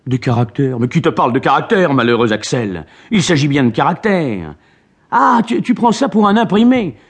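put-on an old professor outraged by ignorance; indignant scolding. A narrator's reading. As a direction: scold the nephew in disbelief. Uncle scolds ignorant nephew.

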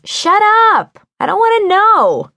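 high, loud yell, cutting him off; shouting. Acted. Wren yelling at Vincent to stop talking, loud and high. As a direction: scream it to block him out. Wren covers her ears.

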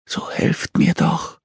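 slow, high whispered plea; faint begging. A narrator's reading. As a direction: beg weakly, drawing out the words. Injured girl begs for help.

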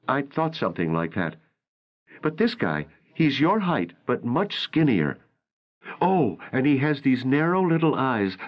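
April's voice. A narrator's reading.

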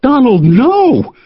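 high, loud cry of no; shouted plea. Acted. Sandy shouting at Donald to stop him, high loud cry. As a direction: yell to stop him, voice jumping up. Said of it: "Sandy shouted out".

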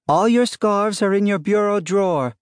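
high, projected call upstairs. A narrator's reading. Wife tells husband upstairs where his scarves are, calling out. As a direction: answer so he hears it a floor away.

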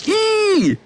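high, sharp yelp of disgust; disgusted shriek. Acted. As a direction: squeal as a wet sponge flies past. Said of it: "schreit Sarah".

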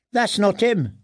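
put-on boy's voice. A narrator's reading. Boy peering into the cell at the wrong prisoner, lightly voiced lad. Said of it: "said a boy's voice".

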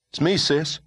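put-on high boy's voice, shaken; a scared little brother; young boy voice. Boy character voice.

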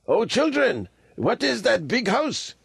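put-on high-pitched old lama; an old holy man calling out high. A narrator's reading. Old lama asking boys the way, high voice.

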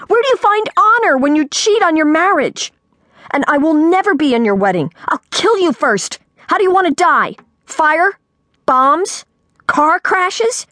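high-pitched, outraged tirade; livid, escalating threats; furious yelling. A narrator's reading. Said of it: "yelled Sophie".

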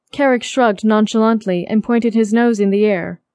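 ordinary delivery

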